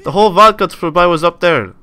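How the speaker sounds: loud, high, hammed-up Russian accent; mock Russian accent. Gamer playing a Russian soldier under gunfire, hammed-up Russian accent. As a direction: put on an excitable Russian accent, voice pushed up and loud. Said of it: "I'm gonna talk with the Russian accent too".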